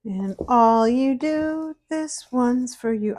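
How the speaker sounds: slow, sung ad line; commercial jingle voice. Retold or imitated. Quoting a TV commercial to viewers, slow and crooning. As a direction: croon it like an old TV ad. I don't remember what that commercial's for.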